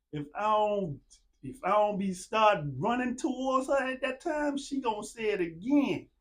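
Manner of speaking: ordinary talk, nothing marked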